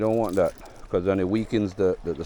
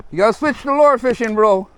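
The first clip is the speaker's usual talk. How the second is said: loud, high teasing call; shouting; 13 semitones higher, 9 dB louder than usual. Real, not acted. Teasing his friend across the water.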